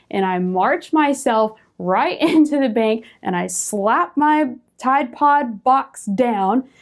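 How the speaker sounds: ordinary talk, nothing marked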